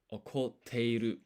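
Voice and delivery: "Okoteiru" slow and loud, with a beat before the T; slow teacher's "Okoteiru" with a punched double T; double consonant. Shown as a demonstration. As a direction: pause after "Oko", then hit the "te" in "Okoteiru". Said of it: "You need that pause and the extra emphasis".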